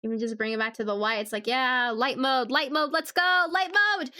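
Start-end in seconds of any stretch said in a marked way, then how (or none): 1.5-4.1 excited cheer. Pretend. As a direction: hype it up like a rally cry. Cheering for light mode.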